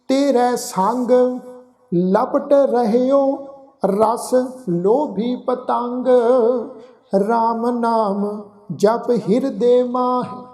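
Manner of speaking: slow, chant-like Gurbani reading; drawn-out verse with pauses between phrases; scripture recitation. Shown as a demonstration. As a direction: recite slowly, stretching each line end.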